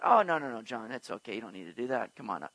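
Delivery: soft, low, easygoing comfort; quietly soothing, voice dropped low; gentle reassurance. Acted. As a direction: let him off gently, in no hurry. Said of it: "He's not taking time to say".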